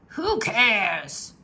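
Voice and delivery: captain roars his dismissal; pirate roar. A narrator's reading. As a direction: bark it like a careless captain. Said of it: "roared Captain Bones".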